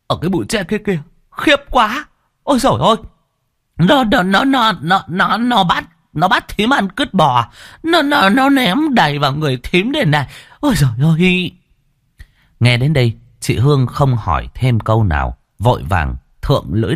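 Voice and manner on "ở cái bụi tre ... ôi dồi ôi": terrified stammering. Acted. Terrified aunt stammers about ghost.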